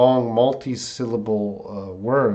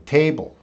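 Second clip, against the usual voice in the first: "Table" with TA pitched up, then falling; stress on first syllable; 4 semitones higher, 3 dB louder than usual. Shown as a demonstration. Teacher answering a stress question, TA stressed in "Table". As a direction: lean on the TA of "Table". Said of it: "the stress is at the beginning".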